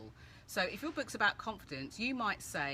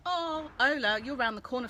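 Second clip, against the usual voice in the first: excited; 6 semitones higher, 8 dB louder than usual. Real, not acted. Friend turns out nearby.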